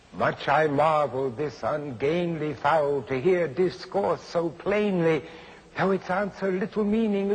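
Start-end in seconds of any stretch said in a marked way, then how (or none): none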